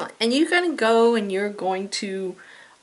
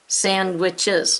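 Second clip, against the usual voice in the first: slow syllable demo; 3 dB louder than usual. Shown as a demonstration. Teacher to her class, slow and drawn out. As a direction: draw out sandwiches slowly. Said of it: "Let's clap it out".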